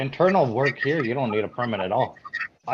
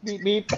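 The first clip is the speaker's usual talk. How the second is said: higher-pitched playful beeps; backup beeping imitation; 7 semitones higher, 3 dB quieter than usual. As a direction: mimic a reversing alarm. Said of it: "Twinkie truck going into reverse".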